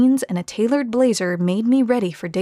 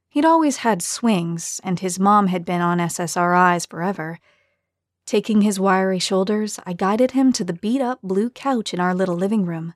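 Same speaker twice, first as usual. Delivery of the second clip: plain reading voice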